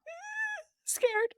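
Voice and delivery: high squeaky mock fright; mock scared yelp. Recalling Instagram trolls to her co-host, a squeaky mock fright. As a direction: pitch it way up like a startled mouse.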